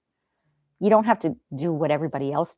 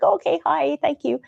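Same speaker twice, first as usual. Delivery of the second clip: much higher, chirpy "Oh, okay, hi"; forced politeness; 10 semitones higher than usual. Retold or imitated. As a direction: sweetly hurry him out. Her reply voiced.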